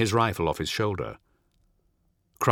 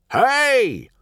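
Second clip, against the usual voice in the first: high, loud call to companions; sharp rise into a loud yell; shout; 7 dB louder than usual. A narrator's reading. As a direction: shout out to get their attention. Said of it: "Ol shouts to his travelling companions".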